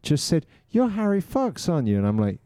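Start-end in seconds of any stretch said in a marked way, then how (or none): 0.7-2.0 high falsetto female voice; high-voiced lady asking a question; imitated woman. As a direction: play the woman who recognizes you.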